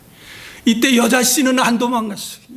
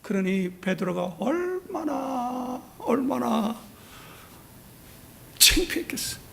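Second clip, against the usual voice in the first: slow fading hush; 5 semitones higher, 8 dB quieter, slower than usual. Real, not acted. Imagining Peter's shame, fading.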